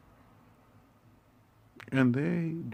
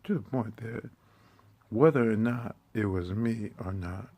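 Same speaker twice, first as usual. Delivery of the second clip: ordinary delivery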